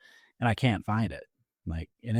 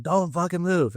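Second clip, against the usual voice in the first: higher-pitched imitation of a yelled warning; mock yell, pitched up without much added volume; retold yell; 7 semitones higher than usual. Stranger with flashlight yelling at the crashed driver, retold yell. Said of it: "I hear this guy just yelling".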